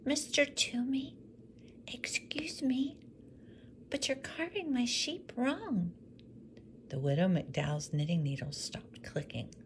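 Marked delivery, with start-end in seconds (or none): 0.0-3.0 much higher, slow whisper; boy interrupting the woodcarver politely. Acted. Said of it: "Thomas whispered". 3.9-5.9 much higher, careful correction; boy pointing out the wrong sheep; little boy. Acted.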